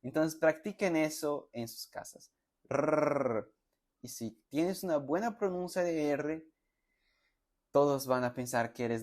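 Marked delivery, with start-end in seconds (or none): 2.7-3.5 a long trilled "Rrr"; rolled R. Shown as a demonstration. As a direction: roll the tongue for "Rrr". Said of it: "su rrr".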